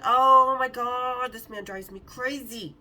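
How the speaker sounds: loud, drawn-out exclamation; exasperated. Real, not acted. Woman venting about a maddening man, exasperated. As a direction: stretch it out slowly in exasperation.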